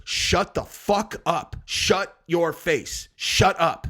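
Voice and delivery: emphatic, slow telling-off; angry scolding. Real, not acted. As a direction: hammer each word separately. Scolding online nerds directly.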